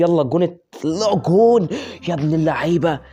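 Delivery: very loud, high screaming; losing it over a goal; celebrate, shouting; wild celebration. Real, not acted.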